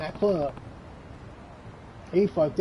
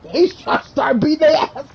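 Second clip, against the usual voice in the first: excited; 11 semitones higher, 5 dB louder than usual. Real, not acted. Hyped retelling of the fight.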